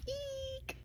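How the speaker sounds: a quick, high, breathy squeal; excited squeal. Real, not acted. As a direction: squeal at the plot twist. Reader squeals at the twist.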